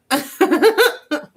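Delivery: high, loud burst of giggles; giggling laugh. Real, not acted. Crafter laughing after chirping like a chick, loud giggle. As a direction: crack up at yourself.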